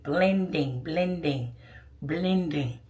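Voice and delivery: lightly imitating a chanted blending line; quoting a funny video. Vlogger quotes a funny video to viewers, lightly mimicking it. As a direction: echo the catchphrase from the viral video, drawn out. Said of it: "Y'all remember that video?".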